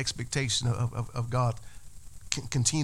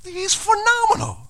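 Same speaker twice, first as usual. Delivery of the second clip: sudden very high, louder squeal; chicken's squeal; 6 dB louder than usual. Acted. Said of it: "the chicken's just like".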